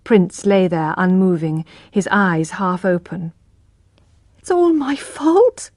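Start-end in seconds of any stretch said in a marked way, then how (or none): 4.4-5.7 high, slow, despairing; mild cry of grief. Acted. Tess over the dead horse, a touch of crying. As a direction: blame yourself in grief. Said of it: "cried Tess".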